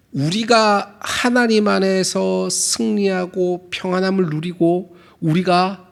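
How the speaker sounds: ordinary talk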